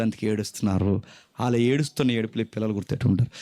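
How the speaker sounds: ordinary talk, nothing marked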